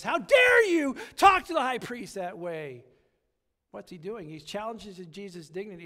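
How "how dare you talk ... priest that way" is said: loud, high outraged shout; angry rebuke. Retold or imitated. Guard slaps Jesus and shouts in outrage. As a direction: bellow the rebuke at the prisoner. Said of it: "pops him on the face and says".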